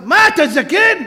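high, loud mock scolding; playful loud jab; teasing rabbi. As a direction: needle him like an old sage. Rabbi teasing the tired walker.

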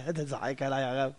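soft chuckling reply to the rabbi; quiet amused youth; laughing young man. Retold or imitated. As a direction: laugh it off, a little embarrassed. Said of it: "אני אומר לו".

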